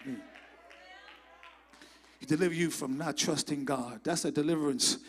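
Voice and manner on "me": shouted testimony, building in force; preaching at full force. Acted. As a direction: stir the church up, louder and louder.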